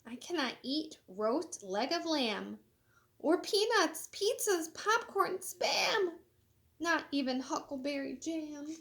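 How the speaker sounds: lively, sing-song storybook reading; animated picture-book read-aloud; reading to children. Acted. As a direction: read it bright and bouncy for kids. Reads clam, jellyfish, hen quotes.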